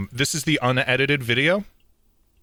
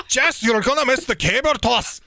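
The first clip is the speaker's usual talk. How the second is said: high, shouty mock Scottish accent; kilted Scotsman; 11 semitones higher than usual. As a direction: holler it as a hammed-up Scot. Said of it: "some guy in a kilt just comes".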